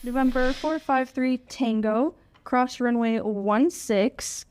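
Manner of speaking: crisp, clipped air traffic control call; radio phraseology. Shown as a demonstration. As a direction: read the clearance like a tower controller over the radio. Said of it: "That's the sound of the next generation of air traffic controllers".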